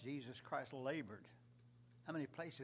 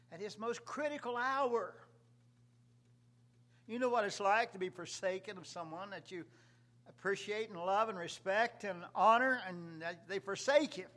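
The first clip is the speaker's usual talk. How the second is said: loud, raised-pitch pulpit delivery; impassioned preaching; 7 semitones higher, 11 dB louder than usual. Real, not acted. Preacher asks congregation about being forsaken, impassioned. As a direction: drive it home at full voice.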